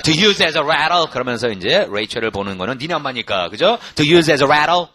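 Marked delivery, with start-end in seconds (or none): 0.0-1.0 loud, very slow "To use as a rattle"; loud slow drill. Shown as a demonstration. Teacher cues students to shadow the line, loudly. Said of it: "시작". 4.0-4.9 much louder "To use as a rattle"; loud drill. Shown as a demonstration. Teacher repeats the line for the class to copy. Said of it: "계속 쓰는 거예요".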